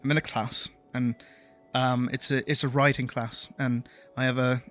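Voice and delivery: measured English accent. Shown as a demonstration. Streamer showing viewers his old voice, English accent. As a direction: slip back into a native-sounding English accent. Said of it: "talk like this ... what I used to sound like".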